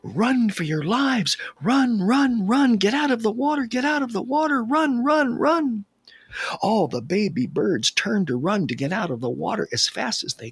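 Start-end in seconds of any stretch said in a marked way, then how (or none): 0.0-5.9 high, frantic cries to flee; urgent pleading. A narrator's reading. Mother bird to chicks as frog strikes, urgent high pleading. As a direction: plead loudly for their lives, pitched way up. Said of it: "The mother bird pleaded".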